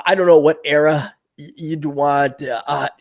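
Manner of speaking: usual speaking voice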